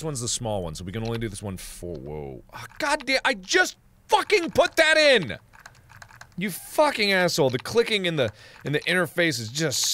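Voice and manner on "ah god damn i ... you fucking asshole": high-pitched furious complaint; exasperated outburst. Real, not acted. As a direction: yell it in exasperation. Game rejects what was entered.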